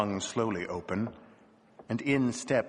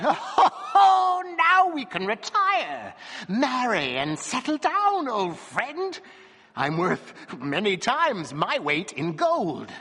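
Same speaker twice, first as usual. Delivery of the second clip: high, loud burst of dwarf's joy, then slowing; joyful cry; 11 semitones higher, 4 dB louder, slower than usual. A narrator's reading. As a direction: crow with delight to your old dog. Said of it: "cried out".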